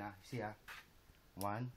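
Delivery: ordinary talk, nothing marked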